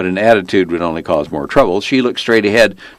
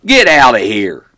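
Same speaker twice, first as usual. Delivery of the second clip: loud, drawn-out holler; yelled back; 5 semitones higher, 5 dB louder than usual. A narrator's reading. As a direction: shout him away, slow and raised. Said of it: "turned around and yelled back".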